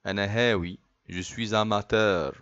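ordinary talk, nothing marked

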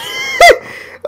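squealing laugh; high-pitched laugh. Real, not acted. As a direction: burst into a shrill laugh.

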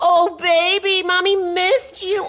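mommy fussing over her dog; gushing pet talk. Real, not acted. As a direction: coo to a beloved pet.

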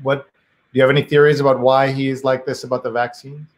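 ordinary talk

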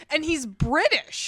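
imitating your own impressed introduction; mock grand announcement. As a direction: announce it like a big deal, slowly. Quotes own habitual proud announcement.

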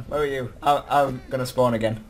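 ordinary talk

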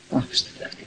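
very soft, half-whispered retold dismissal; hushed dismissal. Saint tells worshippers to leave the cursing woman, hushed. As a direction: drop to a near-whisper, as if waving someone off.

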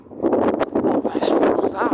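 a loud, breathy, astonished cry; stunned outburst. Retold or imitated. New believer asking the evangelists, loudly astonished. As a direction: blurt it out, shaken by what just hit you. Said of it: "Like this".

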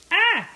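sudden high, loud yelp; startled yelp. Real, not acted. Cooking on camera, startled by the hot pan, sharp yelp. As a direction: yelp as the pan spits at you.

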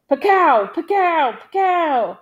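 three high "pakow" shots; imitated gunfire. A narrator's reading. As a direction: punch out repeated rifle cracks. Narrator voices repeated rifle shots.

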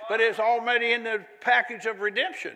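loud, high, building preacher's crescendo; fiery preaching. Acted. Preaching at full force.

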